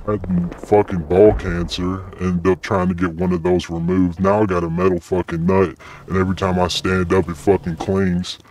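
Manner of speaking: ordinary delivery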